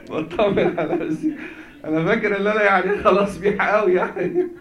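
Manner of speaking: amused self-teasing with real laughs; laughing at himself. Real, not acted. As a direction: laugh it off as you joke about your English. Laughs at his own English.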